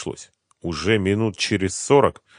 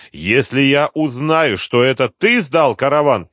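boss's loud, raised threat; unpleasant voice; 8 semitones higher, 7 dB louder than usual. A narrator's reading. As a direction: threaten him, loud and deliberate. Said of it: "сказал хозяин своим неприятным голосом". (неприятный голос, с угрозой)

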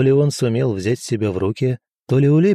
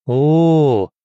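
long, higher, rounded "oh"; drawn out; 4 semitones higher, 5 dB louder than usual. A narrator's reading. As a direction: stretch out a knowing "oh". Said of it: "протянул я".

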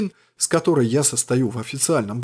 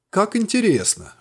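higher, bright exclamation; exclaiming; 7 semitones higher than usual. A narrator's reading. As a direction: exclaim it with feigned delight. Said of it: "воскликнул я". (восклицая)

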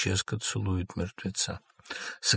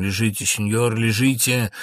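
ordinary talk, nothing marked

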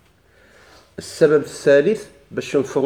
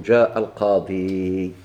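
slow example; 6 semitones lower, much slower than usual. Shown as a demonstration. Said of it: "نقول".

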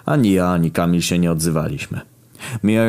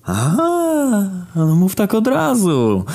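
much higher, slow, mocking buddy voice; Kamil calling out; much slower than usual. Kamil calls out to the narrator, high mocking voice. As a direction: play the friend, high and drawn out. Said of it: "zawołał Kamil".

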